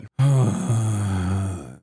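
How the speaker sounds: long, heavy exhale; exasperated sigh. Real, not acted. Streamer reacts to depopulation talk, long exasperated sigh. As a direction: let out a long weary sigh.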